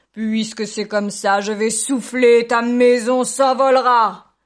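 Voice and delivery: very loud, slow, booming threat; furious wolf. A narrator's reading. Wolf threatening to blow the house down, furious. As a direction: bellow it out, stretching every word. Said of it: "Furieux, le loup dit".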